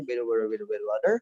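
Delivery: fast American tongue-twister with soft D for T; quick, linked American mumble; American accent. Shown as a demonstration. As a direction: rattle it off American, all flapped T's. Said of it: "orang Amerika ya bilangnya".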